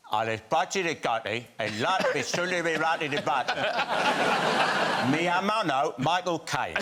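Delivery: hammed-up fake Spanish dub; imitating a Spanish voice-over; mock Spanish. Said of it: "Very good".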